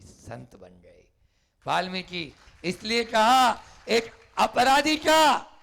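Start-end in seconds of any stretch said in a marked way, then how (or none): 1.7-5.5 emphatic. A narrator's reading. Preacher emphasises the moral.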